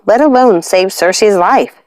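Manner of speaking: ordinary delivery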